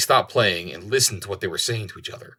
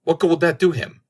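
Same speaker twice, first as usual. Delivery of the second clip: crying out; 8 semitones higher, 5 dB louder than usual. A narrator's reading. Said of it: "the first began again and cried".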